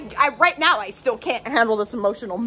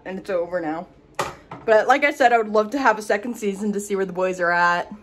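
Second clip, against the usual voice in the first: ordinary delivery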